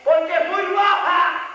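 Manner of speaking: high, drawn-out shouted answer; acted demon's cry, high and slow; demons screaming. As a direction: cry it out slowly as a protesting demon. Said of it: "ellos gritan".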